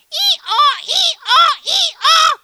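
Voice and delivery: loud high hee-haw bray; donkey braying. A narrator's reading. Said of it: "The donkey brayed".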